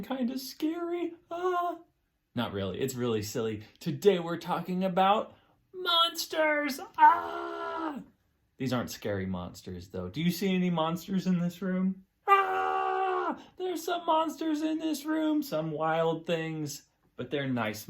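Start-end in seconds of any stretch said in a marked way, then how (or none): 1.3-1.8 mock scream. Mock scare after scary. 5.7-8.0 spooky exclamation. Pretend. Builds up scary monsters. 12.3-15.4 mock fright. Pretend fright at monsters. 15.4-16.8 drawn out. Acted. Drawn-out storytelling phrase.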